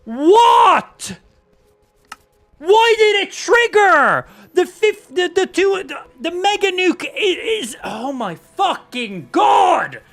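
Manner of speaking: high, loud, stammering outburst; furious disbelief. Real, not acted. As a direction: yell in rage, tripping over the words. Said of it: "I'm so angry right now".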